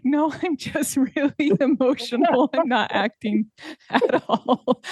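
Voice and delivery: giggling, voice up high and bubbling; laughing through it. Retold or imitated. Telling kids backstage, laughing through the words. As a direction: laugh out the confession. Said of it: "And I was like".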